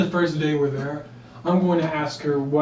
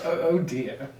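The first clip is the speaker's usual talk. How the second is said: normal talking voice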